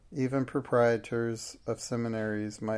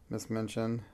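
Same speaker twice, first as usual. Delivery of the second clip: plain reading voice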